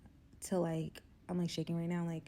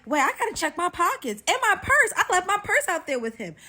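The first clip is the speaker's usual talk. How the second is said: high, loud, hammed-up alarm; mock panic; 11 semitones higher, 9 dB louder than usual. Woman realizing her date steals money, mock panic.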